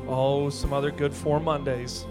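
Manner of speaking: ordinary delivery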